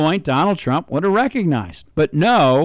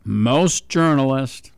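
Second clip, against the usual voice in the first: slow, weighted "journalists"; drawn-out "Most journalists" to underline the word; stress on journalists; slower than usual. Shown as a demonstration. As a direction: lean hard on "journalists". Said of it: "and I underline that word".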